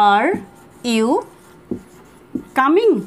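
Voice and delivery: long pauses, rising coming at the end; word by word. Shown as a demonstration. Teacher drilling the question word by word. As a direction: drill each word, lift the last. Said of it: "এটাকে আমরা এইভাবে বলবো".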